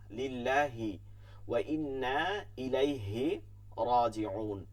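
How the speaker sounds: slow লিল্লাহি ওয়া ইন্না ইলাইহি রাজিউন, every long vowel held; careful recitation. Shown as a demonstration. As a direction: draw out ইন্না and রাজিউন clearly. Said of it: "এটা খেয়াল করব". (ধীরে টেনে শুদ্ধ তিলাওয়াত)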